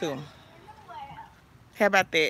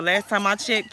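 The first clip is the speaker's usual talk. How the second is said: normal talking voice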